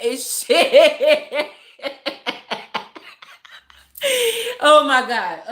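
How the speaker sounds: loud burst of real laughter; helpless laughing fit, then exclaim; cracking up. Real, not acted. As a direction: laugh out the last words. Cracks up after the punchline.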